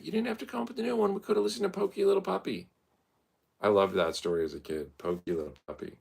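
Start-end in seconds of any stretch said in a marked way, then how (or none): 0.0-2.7 put-on high, fast childish voice; mock kid reply. Kid telling the parent an old book would do, high and fast. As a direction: answer like a kid at bedtime.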